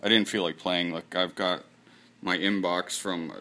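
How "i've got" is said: ordinary delivery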